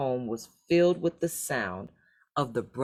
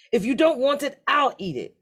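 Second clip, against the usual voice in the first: higher, louder teasing brother; greedy oldest son; 10 semitones higher, 5 dB louder than usual. A narrator's reading. Oldest son taunting his youngest brother at dinner, higher and louder. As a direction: grab the chance with a taunt. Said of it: "said the oldest son".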